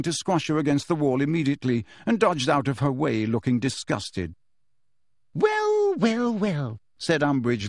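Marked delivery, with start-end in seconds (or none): 5.3-6.8 very high, drawn gloating opening; gloat, starting high and stepping down; triumphant. A narrator's reading. Teacher sees pupil crack, triumphant. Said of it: "looking triumphant".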